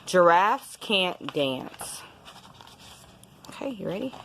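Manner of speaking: plain reading voice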